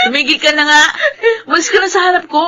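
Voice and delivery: half-laughing, high scolding shout; laughing shoo-away. Acted. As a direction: giggle while pushing him off. Embarrassed coworker shooing teasing friend.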